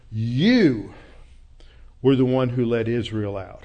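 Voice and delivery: You punched loud, then the rest of "were the one who led Israel out" falls back; a loud You opening the line, set apart by a pause; stress on you. Shown as a demonstration. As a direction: hit the word You hard and pause after it. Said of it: "They're emphasizing that it is you".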